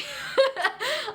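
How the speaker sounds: delighted burst of laughter; high bright laugh. Real, not acted. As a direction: laugh out loud, high and happy. Laugh after cheering viewer.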